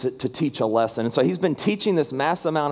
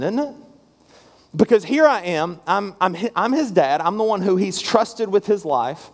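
ordinary talk, nothing marked

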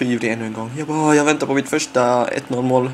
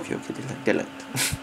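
whispered forgiveness; 5 semitones lower, 12 dB quieter, faster than usual. Retold or imitated. Accepting manager's apology.

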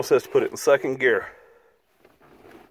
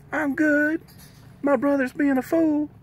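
high voiced dog complaining; dog's voice; 14 semitones higher than usual. Owner voicing Martini the dog answering, high and squeaky.

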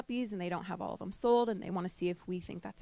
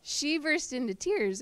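slow, higher voice close to crying; fighting back real tears while telling it; real tearful catch; 6 semitones higher, slower than usual. Real, not acted. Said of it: "okay, I'm gonna cry".

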